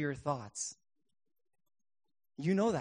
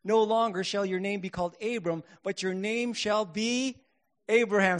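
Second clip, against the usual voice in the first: triumphant reading; 5 semitones higher, 7 dB louder than usual. Shown as a demonstration. Preacher to congregation, building to a triumphant Abraham. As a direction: proclaim it like big news. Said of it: "Here we go, folks".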